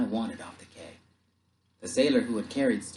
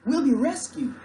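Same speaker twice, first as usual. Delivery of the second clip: high, loud, jubilant shout; excited shout; 8 dB louder than usual. Acted. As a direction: shout the good news. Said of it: "I shouted at Stukat".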